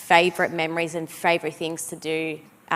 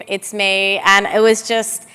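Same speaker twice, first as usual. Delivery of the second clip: ordinary delivery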